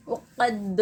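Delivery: उक़द with the final d bounced into a short echo; qalqalah. Shown as a demonstration. Teacher shows students how to do qalqalah, final d bouncing. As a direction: release the d of उक़द with a small "duh" bounce. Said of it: "यहाँ पर हमको खलखला करना चाहिए".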